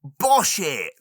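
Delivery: high, loud party shout "Bosh it!"; party cheer. Shown as a demonstration. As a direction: egg a mate on at a party. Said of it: "used in partying vernacular".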